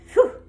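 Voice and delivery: loud puff of relief; relieved phew. Acted. Dog sighs in relief after the danger, loud phew. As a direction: blow out a big phew. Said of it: "said the dog".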